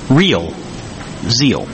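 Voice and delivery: real... zeal, one word at a time; slow, separated words. Shown as a demonstration. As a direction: let each word land on its own. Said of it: "You gotta say that slowly".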